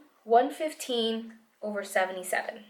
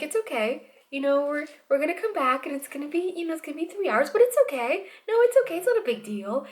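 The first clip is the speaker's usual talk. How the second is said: reassuring nurse; 6 semitones higher than usual. Retold or imitated. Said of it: "she's like".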